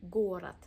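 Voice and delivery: "Город" said loudly, first O long and stressed, second O reduced to A; "Город" as "Горад", first syllable punched loud. Shown as a demonstration. Teacher showing learners the reduced second O. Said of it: "Second O is unstressed".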